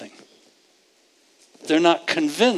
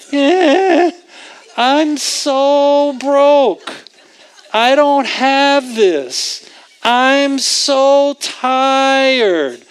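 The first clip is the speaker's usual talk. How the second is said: high, very slow whiny moan with a laugh; adult whining; 7 semitones higher, 4 dB louder, much slower than usual. Retold or imitated. Adult complaining about life in a mock whine. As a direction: drag it out like a self-pitying grown-up. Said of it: "that's how we sound as adults".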